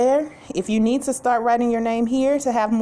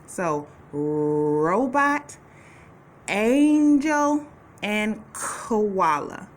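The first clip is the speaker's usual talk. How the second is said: slow, stretched naming of each picture; slow sound-out; much slower than usual. Shown as a demonstration. Teacher sounding out picture names for children. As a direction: say each word slowly so the first sound is heard. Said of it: "Which one of these pictures starts with the A sound".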